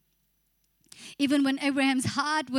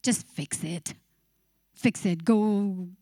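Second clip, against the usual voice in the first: low, pushing inner voice; preacher voices inner pressure urging the believer to act; 7 semitones lower, slower than usual. Acted. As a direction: lower your pitch and push like nagging anxiety. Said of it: "the pressure within you say".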